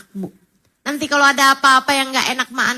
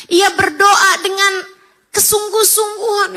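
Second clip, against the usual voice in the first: higher and louder, slow, full-force preaching; fervent preaching; 7 semitones higher, 5 dB louder, slower than usual. Acted. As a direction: preach it with rising fervour. Preacher raising voice on prayer. (ceramah berapi-api, lebih tinggi dan keras)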